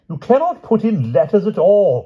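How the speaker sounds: high, loud, exasperated knight; angry cry. A narrator's reading. Sir Palomides objects to the king's letters, angrily. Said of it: "cried Sir Palomides angrily".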